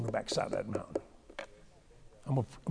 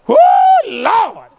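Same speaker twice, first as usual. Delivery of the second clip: whoop; 12 dB louder than usual. Real, not acted. Whooping at the joke.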